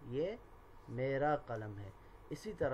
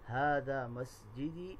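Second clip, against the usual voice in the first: slow drill; slower than usual. Shown as a demonstration. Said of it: "teacher drilling Arabic phrases".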